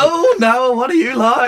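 high hammed-up wife voice, laughing; a put-upon wife chiding Noah, laughing; scolding wife teasing Noah in a high voice; mock wife.

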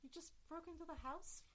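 a scared Kedian hiding from its captor; frightened Kedian. Acted. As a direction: speak it as if hiding behind a door. Sha'al incredulous.

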